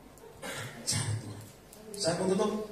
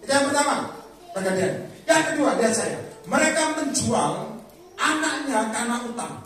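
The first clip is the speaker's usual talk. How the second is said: very loud, high sermon voice; rousing, raised-voice preaching; full-force preaching; 8 semitones higher, 14 dB louder than usual. Real, not acted. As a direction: exhort the congregation with full power.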